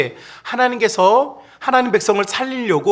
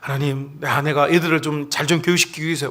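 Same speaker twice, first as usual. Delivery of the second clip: lower-pitched, subdued imitated prayer; praying husband; 4 semitones lower, 4 dB quieter than usual. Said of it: "막 이렇게 기도를 해요".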